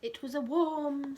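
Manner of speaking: ordinary delivery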